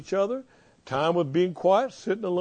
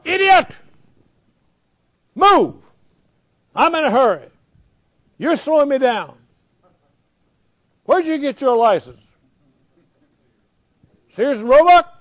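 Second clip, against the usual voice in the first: furious shouting at another driver; road rage yelling; 8 semitones higher, 9 dB louder than usual. Retold or imitated. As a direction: yell it like a driver stuck in traffic. Acted road rage in traffic.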